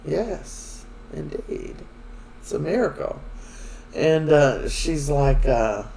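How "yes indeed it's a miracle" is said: softly understated agreement; imitating a man going along with it; playing along. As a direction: humour her with a quiet, mild echo. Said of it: "so he's playing along".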